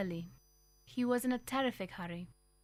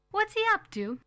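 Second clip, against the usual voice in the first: suspicious mother; 12 semitones higher, 8 dB louder than usual. A narrator's reading. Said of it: "my mother said".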